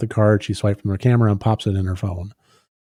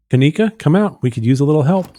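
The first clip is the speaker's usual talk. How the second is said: raised-pitch call to someone; 5 semitones higher, 4 dB louder than usual. Acted. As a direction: call her out of her room. Calling someone out of her room.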